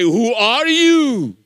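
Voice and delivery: loud, long-drawn exclamation; stretched-out mock astonishment; amazed acted question. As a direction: cry it slowly like an awestruck crowd. Acts out amazed people questioning believer.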